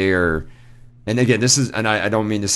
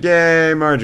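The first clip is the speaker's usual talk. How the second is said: high, louder dutiful "yay"; polite mock cheer; 10 semitones higher, 6 dB louder than usual. Audience politely cheering a bad comic, mock cheer. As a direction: imitate an audience clapping along out of politeness. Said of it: "kinda doing the polite clap".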